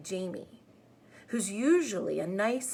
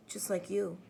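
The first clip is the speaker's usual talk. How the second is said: ordinary delivery, nothing marked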